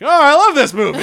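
high, loud delighted gush. Retold or imitated. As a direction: gush like a thrilled kid. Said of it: "And I'm like".

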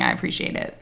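low breathy whisper; whispered aside. Real, not acted. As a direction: throw it away under the breath. Aside after the teasing.

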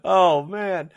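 high, laughter-filled delight; helpless laughing reaction; real laughing. Real, not acted. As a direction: crack up while talking, pitch climbing. Cracking up over a friend's likely reaction.

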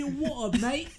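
high Cockney through laughter; laughing Cockney. Acted. Joins in Cockney bit.

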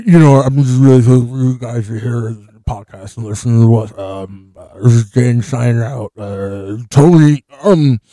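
loud, mock sign-off as Danny; Danny impression. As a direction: boom it out like a podcast host signing off. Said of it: "do your best Danny impression".

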